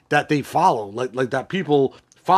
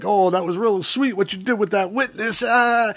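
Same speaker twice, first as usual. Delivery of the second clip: higher-pitched mock chatty voice; lightly acted running-mouth talker, pitched up; imitating D'Angelo's chatter; 6 semitones higher than usual. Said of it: "D'Angelo just starts talking like".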